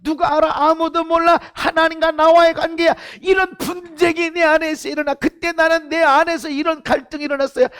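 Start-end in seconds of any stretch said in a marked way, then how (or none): none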